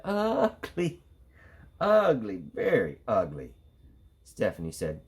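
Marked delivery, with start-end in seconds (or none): 0.1-3.6 high, singsong taunting; children jeering at a classmate; mocking kids. A narrator's reading. Classmates taunting Stephanie, high mocking singsong. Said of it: "the other kids saw her and said".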